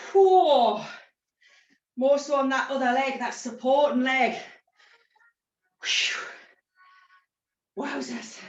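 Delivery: panting after a hard set; breathless gasps between words; out of breath. Real, not acted. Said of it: "God, I'm out of breath with these".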